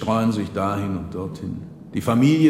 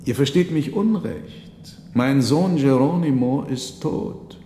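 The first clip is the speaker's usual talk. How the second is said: put-on grieving old father; 6 semitones higher than usual. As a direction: tell the monk your son is dead. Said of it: "versetzte der Alte".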